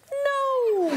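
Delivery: very high, loud squeaked "no"; really high lying voice. Retold or imitated. Actor retells lying to border guard, really high voice. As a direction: imitate a bad liar's falsetto. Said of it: "my voice always gets really high".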